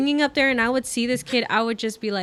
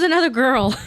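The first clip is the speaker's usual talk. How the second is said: laughing; 5 semitones higher, 5 dB louder than usual. Real, not acted.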